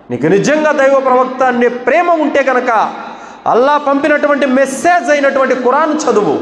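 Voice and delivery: rising sermon voice pressing a list of duties; fiery exhortation. Acted. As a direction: urge the listeners like a pulpit preacher calling them to act. Preacher exhorts the congregation.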